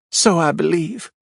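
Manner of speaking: a prim widow spreading scandal on the stand; Marjorie, gossipy widow. A narrator's reading.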